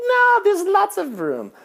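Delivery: high, slow imitation of cheerful friends; mock Filipino friends. Friends insisting the full car has room, high and slow. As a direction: sing it up high and easy.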